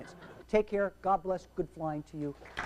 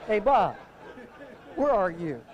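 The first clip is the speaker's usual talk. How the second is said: calling out. Retold or imitated. Pilot looking for his vanished student, calling out. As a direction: call out, then drop on the question.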